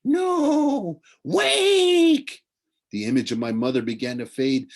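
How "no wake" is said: very high, loud ghostly cry; high loud ghostly warning; spirits crying out. A narrator's reading. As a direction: shriek to wake him. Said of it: "The spirit of the dead whispered around me".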